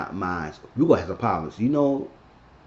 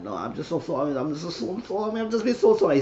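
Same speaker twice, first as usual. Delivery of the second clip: pleading apology; 6 semitones higher than usual. Retold or imitated. Woman apologizing to Doctor Watson, increasingly pleading. Retold apology building to a whine.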